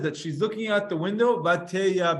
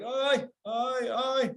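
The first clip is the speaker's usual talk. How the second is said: high, drawn-out sighing ay; demonstrated sighing ay ay ay; sighing demo; 7 semitones higher, much slower than usual. Shown as a demonstration. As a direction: sigh it out like a weary mother. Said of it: "One says she's sighing, like".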